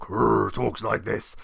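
low growling orc; mild demo of a growling orc; gruff orc voice. Retold or imitated. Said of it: "a very gruff orc".

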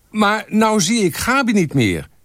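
high, slow shouted complaint; calling out. A narrator's reading. Photographer to the group about a hidden colleague, calling out. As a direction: call it over the road, drawn out.

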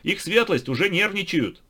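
high, loud hurry-up shout; impatient shout. A narrator's reading. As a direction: shout to hurry them along. Figure urges them on.

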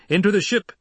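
high, loud urgent shout. A narrator's reading. Officer shouting to the Emperor to board. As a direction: push him to the ship. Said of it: "a Sardaukar officer shouted".

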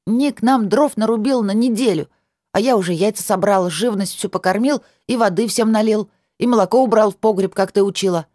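put-on child voice. A narrator's reading.

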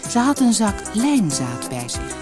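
ordinary delivery, nothing marked

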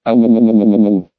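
low bellowing roar. A narrator's reading. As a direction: roar like a beast. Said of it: "Сунь Ся Цзинь взревел".

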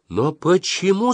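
high, slow, anguished cry; mother shouting. A narrator's reading. Mother shouting at her stubborn son, high and slow. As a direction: cry out at him. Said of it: "закричала мама".

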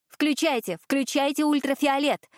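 high urgent shout. A narrator's reading. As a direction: yell the order over the noise. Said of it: "кричала я".

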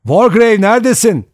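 loud searching call, high pitched; shouting. A narrator's reading. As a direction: holler for a missing man. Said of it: "diye bağırdı". (bağırarak arayış)

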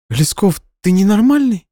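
high, loud incredulous cry; shocked disbelief; astonished. Acted. Nadya stunned by his confession, high incredulous cry. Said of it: "От удивления Надя".

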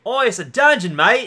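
put-on loud, high Australian accent; mock Australian accent. Co-host reprising the accent joke, loud mock Australian accent. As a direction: crow it in hammed-up Australian.

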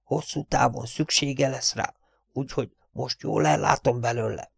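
soft, furtive aside; nervous undertone. Acted. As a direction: keep it down so nobody overhears. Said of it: "nyugtalan pillantást vetett a háta mögé".

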